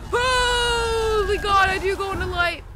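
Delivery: panicked scream; terrified shout. Real, not acted. Terrified horror game scream.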